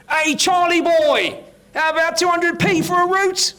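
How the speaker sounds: high, drawn-out holler; yelling out. Acted. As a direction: call out loudly to a passing jogger. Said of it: "and she yells out".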